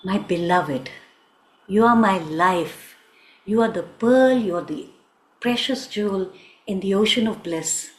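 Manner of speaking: low, measured declaration of love; tender wooing. Acted. Krishna woos his beloved Radha, low and adoring.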